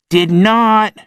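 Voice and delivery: high, loud protest; indignant kid shout. A narrator's reading. Logan arguing with Rob, high loud protest. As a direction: snap back like an offended boy. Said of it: "shouted Logan".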